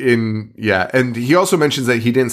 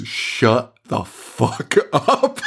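very slow, stretched-out amazement; stunned disbelief; much slower than usual. Retold or imitated. Trevor reacting to a revelation about the kiss, stunned. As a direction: imitate someone floored, word by word. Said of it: "he is so stunned by this revelation".

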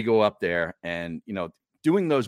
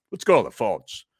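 mimicking Tony; 8 dB louder than usual.

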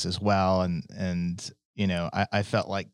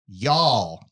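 southern y'all. Retold or imitated. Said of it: "my sister now says".

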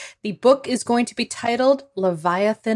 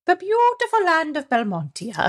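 much higher, grand put-on Irish lilt; mock Irish-tinged accent; 9 semitones higher than usual. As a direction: praise the homeland in a hammy Irish-ish accent. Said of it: "The Belmontian accent has traces of Irish".

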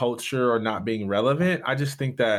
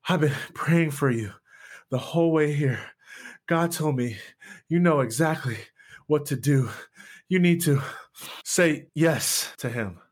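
lightly voiced grandmother, a bit higher, out of breath; breathless grandmother pleading, a touch higher; 4 semitones higher than usual. Grandmother bursts in to tell her grandson, lightly voiced and breathless. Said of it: "she goes".

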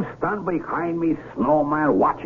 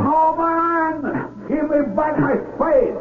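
shouting after him; 13 semitones higher, much slower than usual. Acted. Villagers yelling after Carlos to come back. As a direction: yell after the fleeing man.

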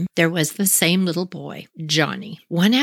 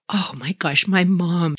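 usual speaking voice

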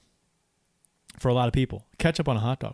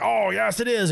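high-pitched mock insistence; mock arguing; 8 semitones higher than usual. Host acting out the hot dog sandwich debate, mock insistent. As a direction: play one side of a silly dispute, pitched up.

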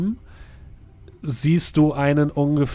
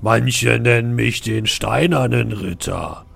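deep, booming giant stone knight; thundering knight voice; 3 semitones lower, 4 dB louder, slower than usual. Acted. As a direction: speak down from a great height, slow and booming. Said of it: "mit donnernder Stimme".

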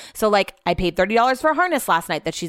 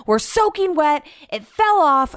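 mock-upset wail, higher pitched; Brett; 8 semitones higher, 4 dB louder, slower than usual. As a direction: imitate him all worked up, raised voice. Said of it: "he's so upset".

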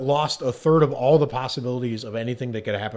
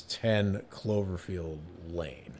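announcer; 6 semitones lower, 10 dB quieter, much slower than usual. Acted. Announcing countdown entry.

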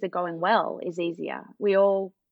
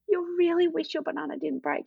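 gentle naming feelings; 9 semitones higher than usual. Retold or imitated. Mother soothes upset toddler.

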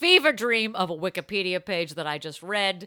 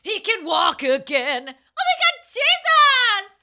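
high, loud revival cry; hammed-up faith healing joy; mock miracle shout; 12 semitones higher, 7 dB louder, slower than usual.

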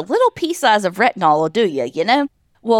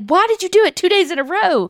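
higher-pitched mock disbelief; imitated scolding; 10 semitones higher than usual. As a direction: question it like an exasperated crowd. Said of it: "Everybody's like".

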